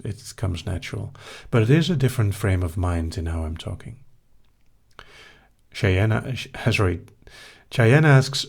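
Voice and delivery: ordinary talk, nothing marked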